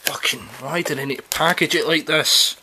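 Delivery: annoyed, swearing at the packaging; exasperated and loud; frustrated outburst. Real, not acted. Struggling to open the packaging.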